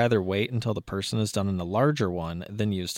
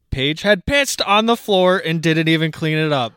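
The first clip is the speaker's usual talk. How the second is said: loud, higher-pitched story reading; outraged reveal; 6 semitones higher, 8 dB louder than usual. A narrator's reading. Podcast host reading the shocking twist to co-host, outraged. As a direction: read it out in raised-voice disbelief.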